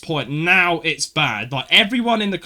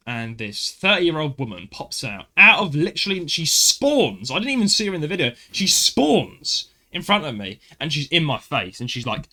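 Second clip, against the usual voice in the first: ordinary talk, nothing marked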